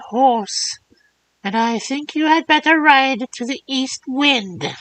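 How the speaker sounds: put-on high, thin old hag voice; old crone voice. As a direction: croak like a kindly old witch. Third old woman speaks at length.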